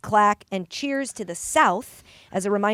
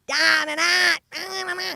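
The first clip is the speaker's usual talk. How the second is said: higher-pitched Pittsburgh gibberish; mock Pittsburgh accent; 6 semitones higher, faster than usual. Host mocking the accent shoved down our throats, mock Pittsburgh accent. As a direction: caricature Pittsburgh speech, pitched up. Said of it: "that's how he talks".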